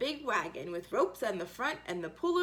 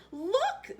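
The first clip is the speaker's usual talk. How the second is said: high shout; 10 semitones higher than usual. Acted. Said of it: "they all shouted".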